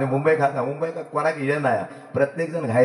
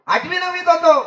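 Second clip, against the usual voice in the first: higher and louder, punching होतो; emphatic repeat; 12 semitones higher, 6 dB louder than usual. Shown as a demonstration. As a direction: lift the repeat up to the crowd. Storyteller repeats line for emphasis.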